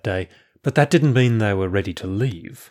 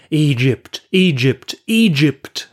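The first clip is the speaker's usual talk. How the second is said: louder, higher, each word set apart; insistent crowd cry; 6 semitones higher, 5 dB louder, slower than usual. A narrator's reading. Crowd answering the prophet, insistent cry. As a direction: hammer out each repeat, building in pitch.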